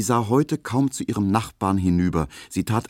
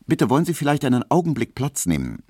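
brisk, higher call across the shop; 9 semitones higher, faster than usual. A narrator's reading. Barber's assistant calling out to a customer, quick and raised. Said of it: "so rief er".

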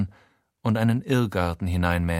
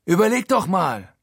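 higher and louder insistent call; 9 semitones higher, 4 dB louder than usual. A narrator's reading. Frankie urging his friend, calling out. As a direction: call it out to convince him. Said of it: "ruft er".

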